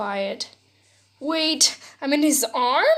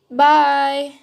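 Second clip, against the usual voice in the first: cheerful call; 3 semitones higher, 9 dB louder than usual. Acted.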